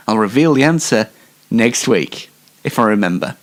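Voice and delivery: ordinary delivery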